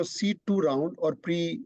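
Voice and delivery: normal talking voice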